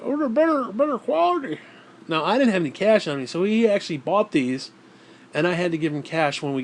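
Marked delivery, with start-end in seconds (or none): 0.0-1.6 high mocking know-it-all voice; mock Dale T. Dale T praising the cheaper patties, high mocking voice. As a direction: sell it in a high silly voice.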